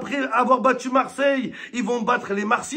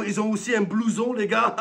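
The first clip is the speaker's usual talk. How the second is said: ordinary talk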